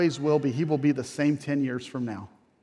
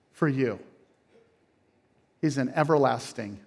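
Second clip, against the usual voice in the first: usual speaking voice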